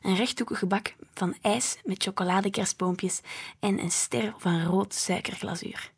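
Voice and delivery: plain reading voice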